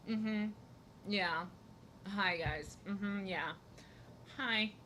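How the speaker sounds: quiet, minimal murmured responses; subdued mock greeting. Streamer mocking a silent streamer who just sits there, subdued replies. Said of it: "Just sit there like".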